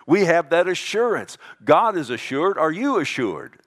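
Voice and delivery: usual speaking voice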